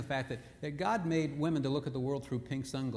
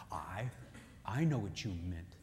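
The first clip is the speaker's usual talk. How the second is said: lower, softer, knowing; knowing murmur; 7 semitones lower, 6 dB quieter than usual. Acted. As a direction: mutter it like you've seen through them. Inner knowing reply.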